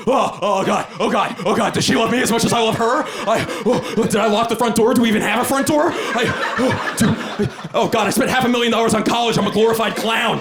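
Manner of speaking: high, fast frantic inner monologue; panicked inner voice. Retold or imitated. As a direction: spiral into bedtime panic. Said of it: "I'm like".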